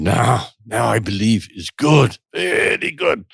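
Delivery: mock German accent, emphatic and deliberate; bad German accent. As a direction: imitate an earnest German man's English. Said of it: "in my worst German accent".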